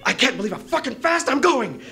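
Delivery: high, loud exhilaration; thrilled. Retold or imitated. Racing down behind Pete, high thrilled shout. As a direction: shout it like wind rushes past. Thrilled thought while skiing fast.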